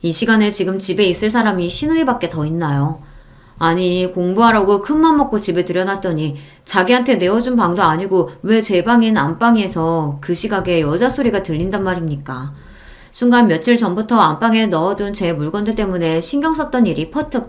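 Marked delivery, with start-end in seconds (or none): none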